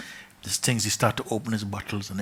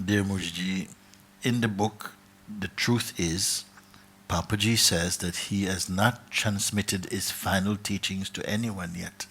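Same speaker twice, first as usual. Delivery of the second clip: ordinary delivery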